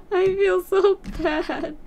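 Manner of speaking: half laughing half crying. Real, not acted. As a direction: giggle through guilt. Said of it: "You might hear me, sulking a little".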